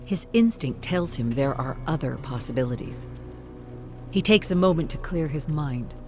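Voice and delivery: usual speaking voice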